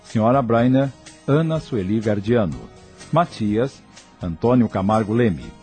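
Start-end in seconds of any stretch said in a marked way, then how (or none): none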